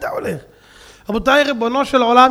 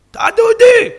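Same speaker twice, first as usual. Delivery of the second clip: triumphant shout; 12 semitones higher, 10 dB louder than usual. Retold or imitated. Thug finds Dudi.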